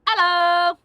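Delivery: loud, high British-style hello; put-on British greeting, pitched high; faux British accent. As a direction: call out in a mock British accent. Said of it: "What accent was that?".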